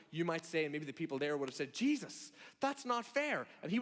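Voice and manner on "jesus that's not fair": protesting listener. Acted. Imagined crowd protests to Jesus.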